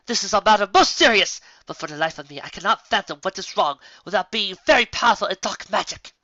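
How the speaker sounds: urgent and solemn, still shaken; alarmed princess. Acted. Luna alarmed continues.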